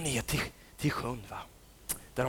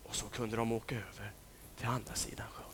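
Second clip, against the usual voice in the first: hushed closing whisper; soft whisper; 6 semitones lower, 15 dB quieter than usual. A narrator's reading. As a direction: whisper it gently. Crossing in calm night.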